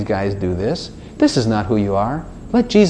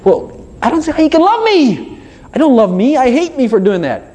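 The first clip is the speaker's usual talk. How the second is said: high, wailing self-reproach; anguished protest; 12 semitones higher than usual. Acted. Sinner telling God he is unlovable, anguished protest. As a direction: protest in a hammed-up high wail.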